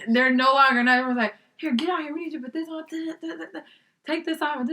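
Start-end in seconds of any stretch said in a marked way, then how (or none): 1.6-4.6 high, softer imitation of barked orders; hurried staff ordering you about, not really shouting; retold bossy orders; mock yelling. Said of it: "They are yelling at me".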